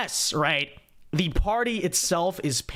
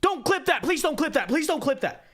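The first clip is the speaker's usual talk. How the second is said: very high, mock-frantic pleading; exaggerated squeaky plea; mimicked knee-jerk panic; 11 semitones higher than usual. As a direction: imitate a panicked beg, pitched way up. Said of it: "Notice the very knee-jerk reaction".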